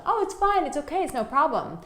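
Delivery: mock people-pleaser, high and fast; fake cheerful. Telling coworkers it's fine.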